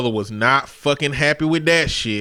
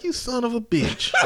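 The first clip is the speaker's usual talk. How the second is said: laughing scold. Retold or imitated. Mock scolding the prankster, laughing.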